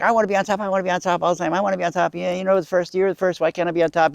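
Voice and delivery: mock whining plea; whiny insisting. Adam keeps nagging, refusing.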